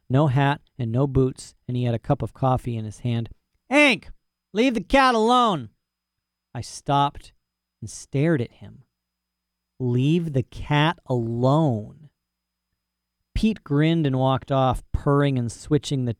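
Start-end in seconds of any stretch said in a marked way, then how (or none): 3.7-5.7 yelling at the dog. Acted. Rancher yells from porch. 9.8-12.1 drawn-out, deadpan repeat of the order; dog repeating the rancher's order slowly to himself; slow flat echo. Acted. Dog echoes the command.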